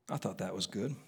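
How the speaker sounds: very soft, low mutter; muttered aside. Real, not acted. Preacher comments on his own line to congregation, muttered aside. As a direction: drop right down as if to yourself.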